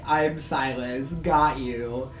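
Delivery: loud, slow, gloating announcement; spring the prank loudly and slowly; hammed-up gotcha; mock villain reveal. Silas's acted reveal.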